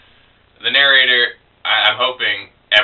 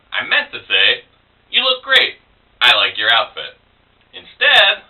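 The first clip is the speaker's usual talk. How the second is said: spoken-word poem with punched turning words; comic storytelling verse, paused between phrases; poetry reading; 4 semitones higher than usual. Acted. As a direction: perform the poem in measured phrases with lively pitch swings. Performs own poem.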